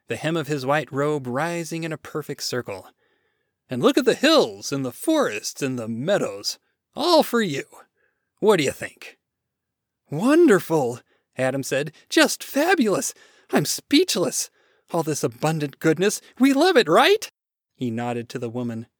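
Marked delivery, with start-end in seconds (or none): 3.7-9.2 loud, showman-like God; proud, enthusiastic God. Acted. God presents the garden. 10.1-11.0 loud, eager-to-please praise; eager gushing. Acted. Adam gushes to please God. 12.1-17.3 high, loud, eager-to-please praise; eager gushing. Acted. Adam gushes to please God.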